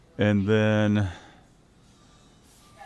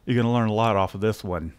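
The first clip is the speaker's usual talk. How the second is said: ordinary talk, nothing marked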